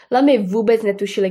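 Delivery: ordinary delivery, nothing marked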